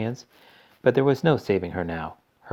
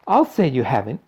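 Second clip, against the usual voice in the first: loud, higher retort; indignant cry; 5 semitones higher, 6 dB louder than usual. A narrator's reading. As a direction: throw it back at him. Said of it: "cried Missus Beauregard".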